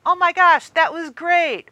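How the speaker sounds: high, loud exclamation; thrilled. Retold or imitated. Rider gushing to a friend after the ride. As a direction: gush to your friend. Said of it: "you look at the friend ... you go".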